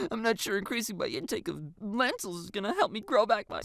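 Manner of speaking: high-pitched sarcastic whine; mock the useless advice; heavy sarcasm. A narrator's reading. Girl on her internet search, sarcastic. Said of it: "Her tone turns heavily sarcastic".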